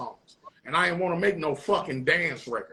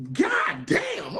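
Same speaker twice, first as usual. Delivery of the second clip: excited shout; 13 semitones higher, 3 dB louder than usual. Retold or imitated. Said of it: "I was like".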